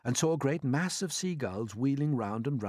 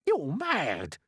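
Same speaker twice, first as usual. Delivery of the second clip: outraged cry; 7 semitones higher, 4 dB louder than usual. A narrator's reading. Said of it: "cried the earthworm".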